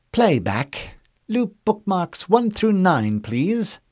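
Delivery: high, slow crisp command; woman's voice calling out. Acted. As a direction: call out the playback order. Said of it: "the woman's voice called out".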